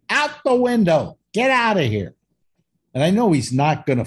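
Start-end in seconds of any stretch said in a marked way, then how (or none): 0.1-2.1 high-pitched shooing yell; retold yell. Man re-enacting chasing a squirrel to the window, yelling. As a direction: order it out in high, drawn falling cries. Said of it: "And then I go".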